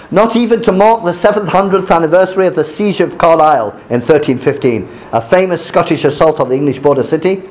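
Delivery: measured read-aloud of a news article. Acted.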